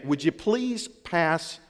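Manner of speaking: slow, gentle "please pass the salt"; polite request. Retold or imitated. Husband at table, asking his wife gently. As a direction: ask your wife softly and slowly. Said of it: "look over at your wife and say".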